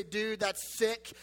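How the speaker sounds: slow, drawn-out surfer bro; bro slang. Retold or imitated. Pastor shows teens the slang he still uses, drawn out slow. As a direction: stretch it out like a laid-back bro. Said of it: "you'll still hear me say".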